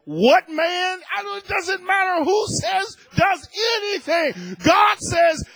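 high-pitched, shouted sermon; impassioned preaching. Real, not acted. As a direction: preach it with full, defiant conviction. Preaches at full force.

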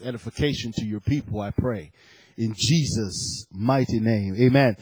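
low, hushed pastoral prayer. Real, not acted. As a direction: pray humbly, dropping low at each phrase end. Leads a short prayer.

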